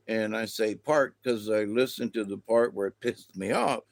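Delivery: usual speaking voice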